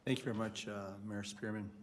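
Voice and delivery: ordinary delivery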